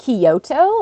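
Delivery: "Kyoto" in three syllables, ki-o-to; visitor's mispronunciation. Retold or imitated. Visitor asking where one lives, "Kyoto" split as ki-o-to. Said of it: "people who come over and be like".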